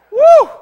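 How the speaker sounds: sudden high-pitched "woo"; high loud whoop. Acted. Preacher caps his near-punch story with a high whoop.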